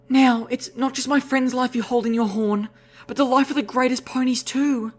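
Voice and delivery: high, earnest unicorn mare. Acted. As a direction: press him gravely. Velvet pleading with Lifebloom.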